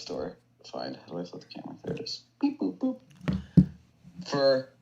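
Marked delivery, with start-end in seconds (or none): 2.4-3.0 high-pitched robotic beep boop. Pretend. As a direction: beep like a little machine. Showing off a lamp with speakers.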